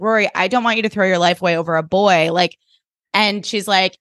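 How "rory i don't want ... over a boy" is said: ordinary talk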